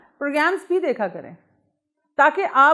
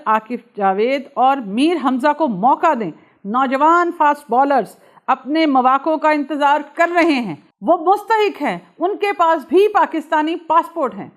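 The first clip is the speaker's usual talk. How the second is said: champion the overlooked players as an irate critic would; projected, declamatory mock anger, names rung out; angry Mohammad Hafeez quote; 4 dB louder than usual. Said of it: "professor صاحب کا غصہ آپ نے دیکھ لیا".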